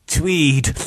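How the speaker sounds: high snuffled reply; snuffling. A narrator's reading. Beaten victim naming names through his bleeding nose, snuffling. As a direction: snuffle it out weakly. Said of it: "Charles snuffled".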